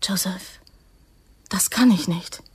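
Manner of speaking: frightened hushed whisper; scared whisper to Joseph. Acted. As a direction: barely breathe the refusal. Refusing to kiss pistol.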